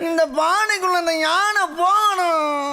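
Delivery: high, slow, drawn-out whine; wailing child. Acted. Jester as child demands elephant go into pot, wailing. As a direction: stretch the demand into a toddler's wail. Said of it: "அந்த குழந்தை".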